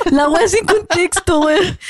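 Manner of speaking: high, slow words through laughter; real helpless laughter. Real, not acted. Friend cracking up at the exchange, laughing hard. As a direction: squeeze the words out while laughing.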